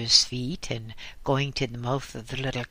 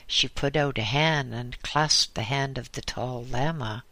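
usual speaking voice

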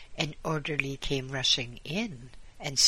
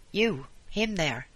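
sharp, raised angry accusation; 5 semitones higher, 4 dB louder than usual. A narrator's reading. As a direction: point him out in anger. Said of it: "said the woman angrily".

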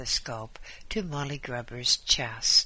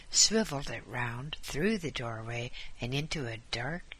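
ordinary delivery, nothing marked

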